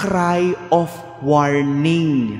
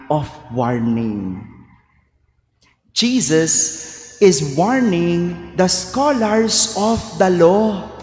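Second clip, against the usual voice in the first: ordinary talk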